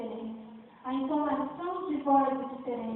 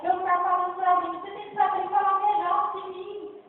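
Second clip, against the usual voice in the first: fervent preaching; 3 semitones higher than usual. Acted. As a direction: drive it home to the congregation. Preaching at full force.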